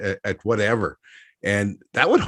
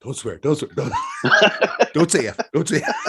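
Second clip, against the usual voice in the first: chanting while breaking into laughter; laughing at his own nervous self-talk; laughing mantra; 12 semitones higher than usual. Retold or imitated. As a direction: repeat it through giggles. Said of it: "I'm like".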